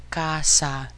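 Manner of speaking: "casa" slowly, both vowels "ah"; pure Spanish A. Shown as a demonstration. Teacher showing A never changes, "casa" slowly with two pure As. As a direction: stretch "casa" into "KAH-sah". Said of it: "An A is always A".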